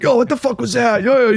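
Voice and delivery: high, loud confrontational yelling; aggressive yell. Retold or imitated. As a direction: pick a fight with your voice. Senior shoving guys at practice.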